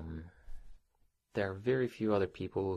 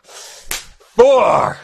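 loud, high punched count; shouted count; 14 semitones higher, 24 dB louder than usual. Acted. Boss counting out a punishment to employee, sharp shout. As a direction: call each number out hard.

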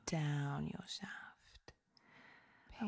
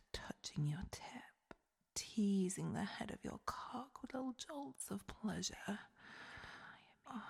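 ordinary delivery

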